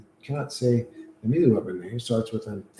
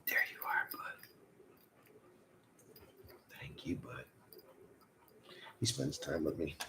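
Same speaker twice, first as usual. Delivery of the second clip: whispered, softer thanks to the dog; 6 semitones lower, 16 dB quieter than usual. Real, not acted. Thanking his dog for sitting with him, whispered. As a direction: breathe it gently to the dog.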